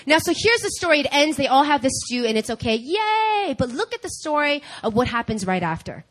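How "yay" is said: high, drawn-out little hooray; mock cheer. Preacher capping the stew story's ending, mock cheer. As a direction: cheer lightly as if all is solved.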